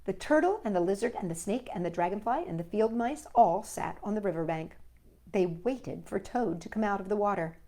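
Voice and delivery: ordinary delivery, nothing marked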